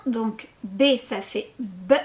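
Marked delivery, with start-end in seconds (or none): 1.6-1.9 "B" dit comme le son "be", bref, sans é; son de la lettre. Shown as a demonstration. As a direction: donne le son de "B", pas son nom. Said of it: "ils connaissent les sons". (« B » prononcé comme un son, be)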